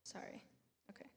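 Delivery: whispered apology to herself. Real, not acted. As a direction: breathe a quick sorry. Nervous pause at the podium.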